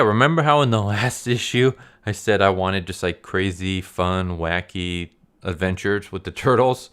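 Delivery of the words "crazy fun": ordinary delivery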